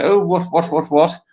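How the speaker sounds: high, fussy upper-class English spluttering "what, what"; mock a posh twit being asked for an autograph; posh toff. Mocking Lawrence as a posh twit, high, fussy and spluttering. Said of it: "he's gonna say".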